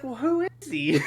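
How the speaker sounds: put-on high-pitched kid retort; imitated little boy. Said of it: "he's like".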